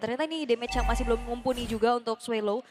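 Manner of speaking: ordinary talk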